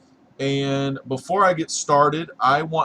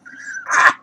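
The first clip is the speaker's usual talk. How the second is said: creepy high cackle; 7 dB louder than usual. Acted. Spooky host cackle.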